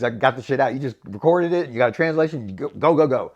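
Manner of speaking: normal talking voice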